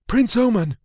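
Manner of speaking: mild far-off call; distant call. Acted. Servant calling the prince from outside, distant. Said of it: "a faint call outside".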